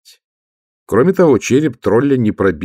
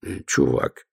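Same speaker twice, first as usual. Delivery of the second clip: muttered from the side of the mouth; 5 semitones lower, 5 dB quieter, slower than usual. Acted. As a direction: speak out of the corner of the mouth. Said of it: "подсказал Ваймс уголком рта".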